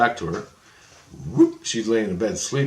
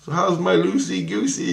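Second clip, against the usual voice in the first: put-on high, louder cooing to a child; playful baby talk; 9 semitones higher, 4 dB louder than usual. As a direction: greet a little girl by her pet name. Teasing the little girl.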